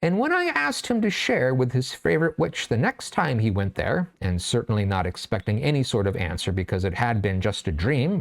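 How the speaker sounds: plain reading voice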